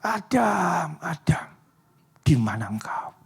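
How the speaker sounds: hushed, breathy calling of Adam's name; whispered call. Acted. God grieving, calls for lost Adam in a whisper. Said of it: "Dia teriak".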